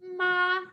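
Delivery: "Ma" sustained high and even; steady high tone. Shown as a demonstration. Teacher repeating the word for mom, steady level tone. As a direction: hold "Ma" high without any bend. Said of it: "you keep your voice steady".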